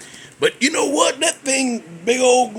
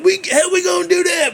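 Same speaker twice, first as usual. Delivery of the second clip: mock exasperated shout; 9 semitones higher, 4 dB louder, faster than usual. Elf answers back, exasperated.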